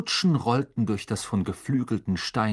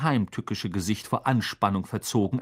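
ordinary delivery, nothing marked

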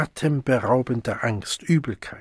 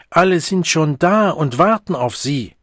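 raised, louder call through a locked door; shouting through a door; 5 semitones higher, 5 dB louder than usual. A narrator's reading. Siegmund shouting to the Savage behind a locked door, loud.

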